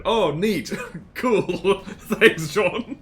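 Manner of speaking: delighted chuckling through the words; laughing thanks. Real, not acted. Streamer thanking viewer who approves the accent, laughing.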